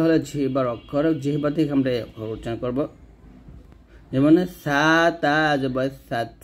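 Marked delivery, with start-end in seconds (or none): 4.6-6.3 "সাত" with tongue-tip lisped start and breathy final ত; tongue to upper teeth for "সা", puff air ending "সাত"; hams on final ত. Shown as a demonstration. Teacher shows tongue letters, "সাত" lisped with released breath. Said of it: "তাকে গিয়ে ধরে হামস করবেন".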